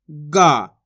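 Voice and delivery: long, loud गा with the vowel held; drawn-out vowel. Shown as a demonstration. Teacher showing pupils the long matra, गा drawn out. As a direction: stretch the vowel of गा, falling away. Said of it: "इसको खींच के पढ़ेंगे".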